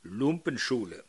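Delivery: ordinary talk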